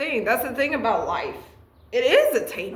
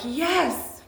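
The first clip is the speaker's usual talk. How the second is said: emotional; 8 semitones higher than usual. Real, not acted. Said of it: "I'm feeling really emotional".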